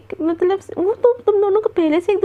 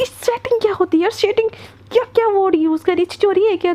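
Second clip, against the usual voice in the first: retold yell; 5 semitones higher than usual. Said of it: "वहाँ पे चिल्ला देते".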